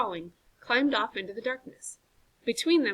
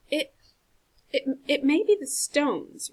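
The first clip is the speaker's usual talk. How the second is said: slow, halting stammer, higher; 6 semitones higher, 5 dB louder than usual. Acted. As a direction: trip over the first words. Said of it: "Rowan stammered".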